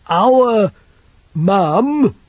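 put-on high, slow, childlike big lad; simple lad voice. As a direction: voice him like a big simple boy.

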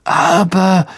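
mildly flustered; startled, faltering interjection; stammered protest. A narrator's reading. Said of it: "stammelte Fudge".

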